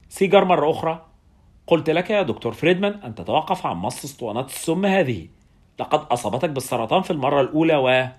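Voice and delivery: ordinary delivery, nothing marked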